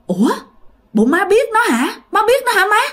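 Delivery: high, astonished questioning; ngạc nhiên hỏi dồn. Acted. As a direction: ask in high-pitched surprise. Aunt startled, questions grandmother. (thím ngạc nhiên hỏi, giọng cao vút)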